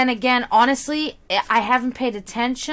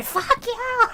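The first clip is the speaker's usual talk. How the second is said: high-pitched pretend fury, held back in volume; squeaky mock rage; mock angry scream; 11 semitones higher than usual. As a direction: play at screaming angrily without full volume. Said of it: "You're just screaming angrily at everybody".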